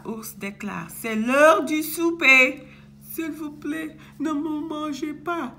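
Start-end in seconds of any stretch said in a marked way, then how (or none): 0.9-2.6 raised, much louder mealtime call; loud announcement. Acted. Papa ours declares mealtime, loud call. 3.1-5.5 higher, anxious little plea; pleading. Acted. Boucle d'Or begs the bears, pleading. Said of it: "supplie Boucle d'Or".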